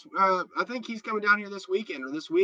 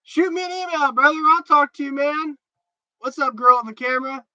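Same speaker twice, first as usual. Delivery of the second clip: much higher and louder, pumped; excited call-out; 9 semitones higher, 5 dB louder than usual. Real, not acted. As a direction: call out to a buddy with hype. Calling out to a friend.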